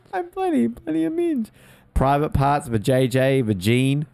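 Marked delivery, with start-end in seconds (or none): 0.1-1.5 high mocking voice.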